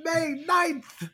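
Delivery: high-pitched, loud complaint; playful over-the-top yell; mock yelling. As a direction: shout it up high in mock outrage. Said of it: "My cat is yelling at me".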